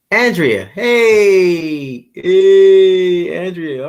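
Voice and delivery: loud, high, drawn-out "hey"; calling out greeting. Real, not acted. Host welcoming Andrea to the stream, calling out. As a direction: hail a friend who just arrived.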